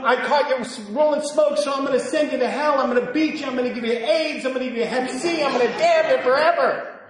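high, building list of threats; mock tirade. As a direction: rattle off exaggerated threats. Mock punitive God threats.

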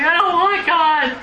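a high, crying wail of refusal, half laughing; mock sobbing protest. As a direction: whine it like a sobbing child, mostly laughing. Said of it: "I was, like, sobbing".

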